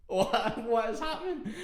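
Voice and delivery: high, laughing squeal of bewilderment; retold panic squeaked through laughter; bewildered disbelief. Storyteller re-enacts hearing the date crying behind, bewildered disbelief.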